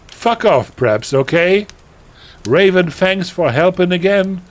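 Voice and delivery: put-on high, loud, gushing teenage voice; melodramatic teen author. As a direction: read it like an overexcited goth girl. Reads author's note in character.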